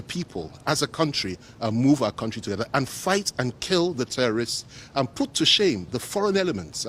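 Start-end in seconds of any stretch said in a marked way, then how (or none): 5.9-6.9 higher pitch on "foreign elements", then falling; "foreign elements" pitched up for emphasis; emphatic. Real, not acted. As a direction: lean on "foreign elements" as the key phrase. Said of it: "I emphasize that word".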